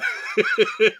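high light chuckle; a brief laugh to round off the question; short laugh. Real, not acted. Host chuckling after his question.

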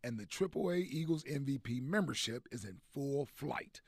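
upbeat radio ad read, big finish. Acted. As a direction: sell it like a sponsor spot. Sponsor ad read on air.

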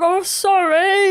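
high, slow sheepish apology; sheepish alarm voice. Acted. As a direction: apologise like a timid machine.

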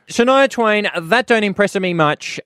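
high, sing-song quoting of the title; mock song quote. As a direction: toss off the song line.

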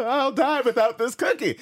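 high, loud mock wailing; hammed-up pleading and fake crying; desperate begging child. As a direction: beg mom as if dying for it. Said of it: "not that strong".

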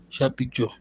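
usual speaking voice

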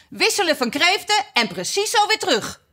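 high-pitched dance-caller cry; excited, high acted call. A narrator's reading. Gryphon calling out dance steps.

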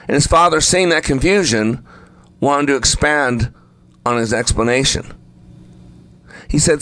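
ordinary delivery

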